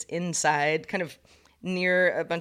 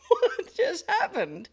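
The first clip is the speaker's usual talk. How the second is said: laughing disbelief; 11 semitones higher, much slower than usual. Retold or imitated. Laughing disbelief after the sting.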